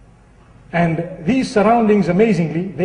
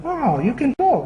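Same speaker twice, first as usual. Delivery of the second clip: higher-pitched mock amazement; amazed; 6 semitones higher, 4 dB quieter than usual. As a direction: marvel at a talking bird. Amazed passers-by address the parrot.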